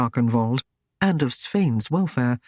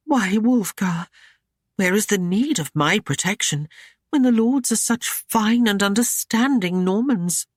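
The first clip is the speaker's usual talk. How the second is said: higher, feigned innocent woman's voice; coy young lady; 7 semitones higher than usual. Acted. Ashlyn feigning innocence to the knight, higher and coy. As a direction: play innocent, sweetly teasing.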